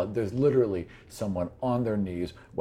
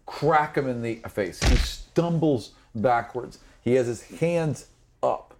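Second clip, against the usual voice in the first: ordinary delivery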